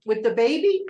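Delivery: sudden alarmed jump up. Retold or imitated. Agent hearing of a problem, asks in alarm. Alarmed question in retold call.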